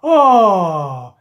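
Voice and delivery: loud, long falling oh; crowd's groan. A narrator's reading. Everybody watching the rope slip, disappointed groan. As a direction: let disappointment slide downward. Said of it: "said everybody".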